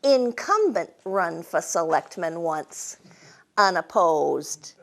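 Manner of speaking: put-on old New England accent. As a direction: tell it like an old New Hampshire local. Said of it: "J P said".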